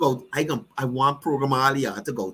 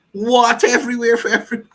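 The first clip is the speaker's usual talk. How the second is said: laughing mock announcement; 6 semitones higher, 5 dB louder, much faster than usual. Mocking the rally promise.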